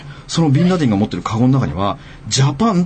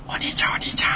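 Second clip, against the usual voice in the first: softer, whispered sister calling her brother; imitated whisper; 9 semitones higher, 8 dB quieter than usual. Retelling his sister's whisper in the store. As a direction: hush it like a nervous sister. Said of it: "って小声で喋りかけてくるわけ".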